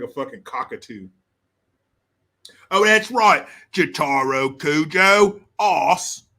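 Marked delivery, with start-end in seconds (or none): 2.7-6.2 loud, hammed-up Cockney accent; big put-on British accent; mock British accent. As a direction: crow it in broad fake Cockney. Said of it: "making fun of these damn accents".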